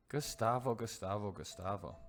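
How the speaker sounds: groaning name. Real, not acted.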